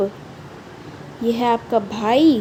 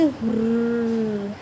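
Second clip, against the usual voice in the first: ghost sound; 2 semitones lower, 4 dB quieter, much slower than usual. Shown as a demonstration. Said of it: "ऐसे भूत की sound".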